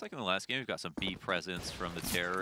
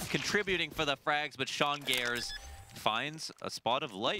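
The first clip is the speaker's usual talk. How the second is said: raised-pitch esports commentary; live play-by-play; 7 semitones higher, 3 dB louder than usual. Real, not acted. Caster calling the trade for viewers, excited play-by-play. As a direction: build the call as the frags come in.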